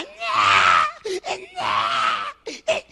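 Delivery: preacher mocks his daughter's nighttime groan; imitated groaning whine. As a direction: mimic a child's sleepy groan. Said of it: "ella siempre a la hora que yo andaba".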